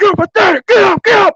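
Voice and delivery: high, loud berating shout; imitated sergeant yelling. Drill sergeants over a kid on the ground, mock yelling. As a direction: berate a recruit at full volume. Sergeants yell at him to rise.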